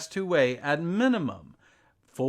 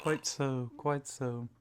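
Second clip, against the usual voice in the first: posh oxford professor; 6 dB quieter than usual. Acted. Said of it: "making a complete sentence out of the word quite".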